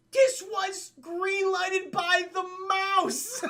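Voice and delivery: high, loud, drawn-out disbelief; shouted amazement tipping into laughter; incredulous outburst. Real, not acted. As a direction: exclaim it slowly, about to crack up. Incredulous aside, breaking into laughter.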